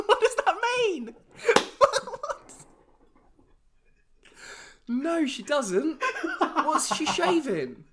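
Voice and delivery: high, loud laughing incredulity; can't stop laughing at the absurdity; real laughing disbelief. Real, not acted. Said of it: "laughing disbelief at bum shaving".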